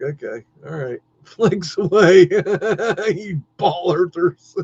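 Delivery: mocking retort. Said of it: "It's like".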